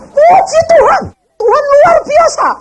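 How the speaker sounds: very high, loud exultant hallelujah; joyful cry. Retold or imitated. Freed prisoner crying out to God, high and loud. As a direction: burst out in ecstatic praise.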